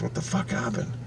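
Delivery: hushed, baffled whisper; voiceless retold bewilderment; whispered disbelief. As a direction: breathe out the question as a stunned thought. Acting out his stunned thought.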